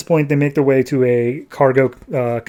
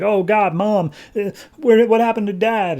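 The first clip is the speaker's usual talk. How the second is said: high-pitched mock panic; alarmed kids; 8 semitones higher than usual. Other kids ask mother about their dad, high mock alarm. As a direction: imitate worried kids, voice pitched well up. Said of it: "all the other kids are like".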